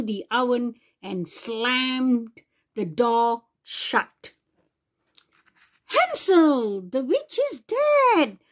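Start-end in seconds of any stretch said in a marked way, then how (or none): 5.9-8.4 put-on high, excited girl's cry. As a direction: call out the happy news. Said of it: "cried Gretel".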